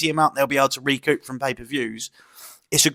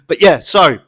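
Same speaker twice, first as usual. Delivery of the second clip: ordinary talk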